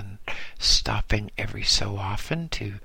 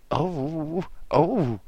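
higher, startled gasp; 6 semitones higher than usual. A narrator's reading. Master realises who the boy is, a startled gasp. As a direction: gasp it out, caught off guard. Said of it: "gasped the master".

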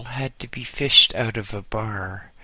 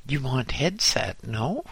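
ordinary delivery, nothing marked

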